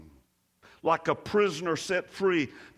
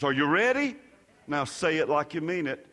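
ordinary delivery

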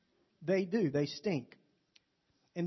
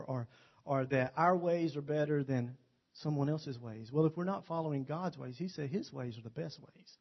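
normal talking voice

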